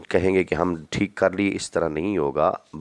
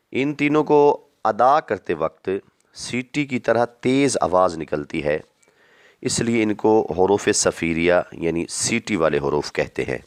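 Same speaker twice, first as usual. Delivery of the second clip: ordinary talk, nothing marked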